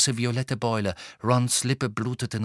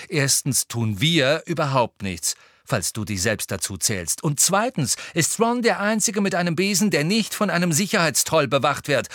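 higher, building irritated rebuttal; annoyed; 5 semitones higher, 4 dB louder than usual. A narrator's reading. Harry turning on Luna, annoyed rebuttal. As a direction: count off objections crossly, building in force. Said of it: "erwiderte Harry ärgerlich".

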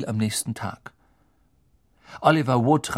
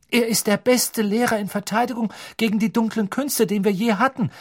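higher, bold defiant voice; daring; 10 semitones higher than usual. A narrator's reading. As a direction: stand up to the teacher. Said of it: "sagte Dean Thomas wagemutig".

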